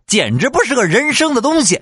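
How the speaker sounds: high furious insult; dad cursing the kid mid-beating; angry dad. Retold or imitated. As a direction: spit it out up high. Dad scolding while beating.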